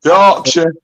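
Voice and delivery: put-on Yorkshire accent.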